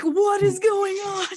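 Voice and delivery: slow, high cry of disbelief; shocked shout. Retold or imitated. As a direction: retell it as a drawn-out laughing shout. Retold shock at phone going off.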